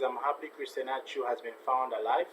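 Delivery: ordinary talk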